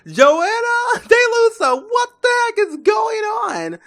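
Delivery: high, loud, drawn-out yell at his wife; exasperated yelling. Acted. Husband confronting Joanna about what is going on, exasperated yelling. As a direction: holler her full name, stretching out the outrage.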